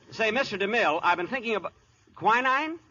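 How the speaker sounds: eager phone voice. Acted. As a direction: try again to get a word in. Keeps trying to pitch story.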